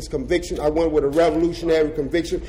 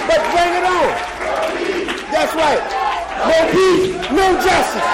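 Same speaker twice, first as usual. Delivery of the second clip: higher and louder, building to a shouted "stand up"; fiery rally speech; 13 semitones higher, 5 dB louder than usual. Real, not acted. Former death row prisoner rousing socialist comrades, fiery preaching. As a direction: whip the crowd up, voice climbing to a shout. Rally chant closing section.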